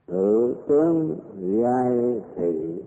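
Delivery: very slow dictation; dictating. Shown as a demonstration. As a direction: give each word time to write. Dictating next phrase slowly.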